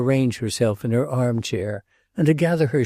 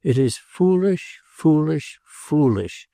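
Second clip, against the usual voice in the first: slow, higher, weighty repetition; slowly, impressively; 5 semitones higher, slower than usual. A narrator's reading. Madame Grandoni scolds the prince, slow and impressive. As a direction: press each foolish home, slowly. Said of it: "said Madame Grandoni, slowly, impressively".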